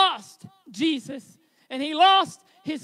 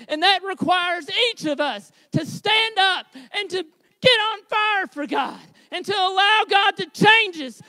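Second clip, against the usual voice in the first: loud, higher rallying call; preaching at full force; 4 semitones higher, 4 dB louder than usual. Real, not acted. Pastor calling the church to get on fire, full-force preaching. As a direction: fire the congregation up, near shouting.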